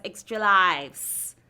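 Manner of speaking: slow drawn-out extra lives. Shown as a demonstration. Host teasing players, drawn out slow. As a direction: stretch it slowly.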